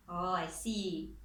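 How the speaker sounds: ordinary talk, nothing marked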